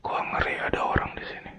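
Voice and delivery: quick frightened whisper; scared whisper. Real, not acted. Alone at night hearing footsteps, scared whisper. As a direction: whisper fast so no one hears you.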